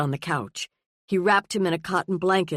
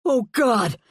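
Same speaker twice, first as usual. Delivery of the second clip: sudden cry, higher and louder; cried out; 6 semitones higher, 5 dB louder than usual. A narrator's reading. Father crying out on finding his lost son. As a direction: burst out in relief. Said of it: "he cried".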